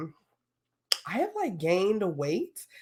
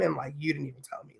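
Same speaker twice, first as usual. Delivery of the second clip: pretend-hurt whisper; 3 semitones lower, 8 dB quieter, faster than usual. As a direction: breathe it out, mock wounded. Pretend complaint to boyfriend.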